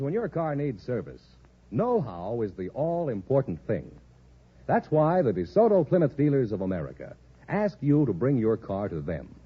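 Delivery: ordinary talk, nothing marked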